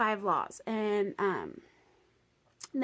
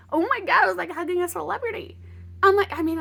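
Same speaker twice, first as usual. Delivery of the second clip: higher and louder, fast; excited gush; 9 semitones higher, 8 dB louder, faster than usual. Real, not acted. Telling friends about hugging a celebrity, thrilled. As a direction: burst out with giddy excitement.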